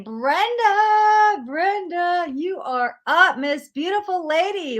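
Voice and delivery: high, bright sing-song calling of the name; excited call-out. Real, not acted. Reader calling Brenda up next, excited sing-song. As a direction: welcome her like a friend arriving.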